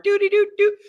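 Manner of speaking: high sing-song little vocal riff; playful doo-dee-doo. Pretend. Host plugging his show's contacts, playful high doo-dee-doo.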